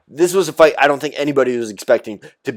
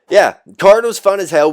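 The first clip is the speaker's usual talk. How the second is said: higher and louder burst of enthusiasm; excited; 7 semitones higher, 6 dB louder than usual. Real, not acted. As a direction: pop it like a fan hyped on the card. Wrapping up an exciting card.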